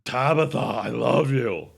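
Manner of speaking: loud, slow, low ogre voice; big drawn-out ogre bellow of affection; character voice. Acted. Ogre speaks to his dog Tabitha, loud low character voice. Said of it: "in your character voice".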